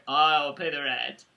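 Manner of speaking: slow, low mock hero; hammed-up hero to the rescue; melodrama hero. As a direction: play the gallant rescuer, drawn out.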